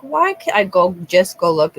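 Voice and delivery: husband offering to go check the rabbit; quoting husband. Retold or imitated. Husband quoted in story.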